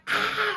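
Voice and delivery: high loud mock scream; retold scream. Mum in the car, screaming at her son in frustration, high and loud. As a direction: let out a short shriek. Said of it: "it's fucking screaming".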